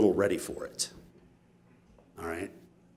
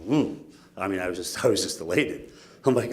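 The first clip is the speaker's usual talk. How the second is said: normal talking voice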